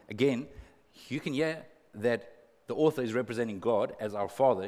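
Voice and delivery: ordinary talk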